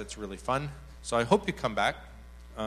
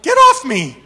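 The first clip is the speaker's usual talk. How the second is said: high, loud protest on a laugh; comic shove-off shout; mock alarmed yell; 16 dB louder than usual. Speaker as woman jumps on his lap, mock alarmed yell. Speaker retells being jumped on.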